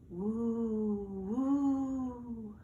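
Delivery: long hollow woo of the wind; wind howl. A narrator's reading. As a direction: moan it like wind through trees. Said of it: "the wind blew in the trees".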